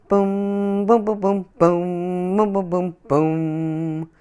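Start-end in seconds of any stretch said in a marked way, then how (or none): none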